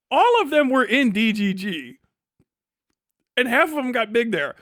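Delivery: ordinary talk, nothing marked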